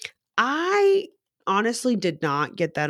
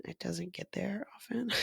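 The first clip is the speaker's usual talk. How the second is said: soft whispered confession; hushed admission before a laugh; sheepish whisper; 13 dB quieter than usual. Real, not acted. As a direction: let it slip out as a whisper. Admitting she rarely manages empathy.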